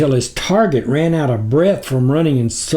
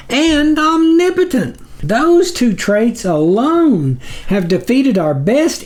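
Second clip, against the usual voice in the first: higher, nervous pleading demon voice; a higher, fawning minion begging the sublord for mercy; cringing underling; 8 semitones higher than usual. A narrator's reading. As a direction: grovel up high before your master.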